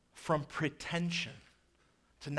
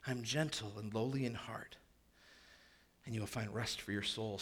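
whispered, lower and much softer; tender whisper; 6 semitones lower, 9 dB quieter than usual. Acted. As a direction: breathe it out gently as reassurance. Jesus' words voiced softly.

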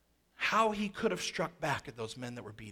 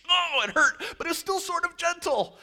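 higher and louder mock yelp of pain; mock pained cry; 13 semitones higher, 7 dB louder than usual. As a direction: ham up a whipped man's wail. Mocking the whipped men.

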